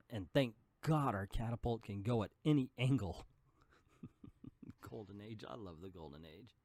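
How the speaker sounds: ordinary delivery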